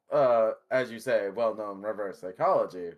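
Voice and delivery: ordinary talk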